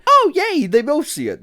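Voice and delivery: high-pitched delighted yay; gleeful shriek at the screen; excited squeal. Real, not acted. Excited squeal at game scene.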